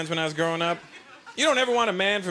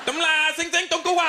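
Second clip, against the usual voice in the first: mock Chinese gibberish; 10 semitones higher than usual. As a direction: fake Chinese chatter, pitched well up. Shopkeeper talks to his wife.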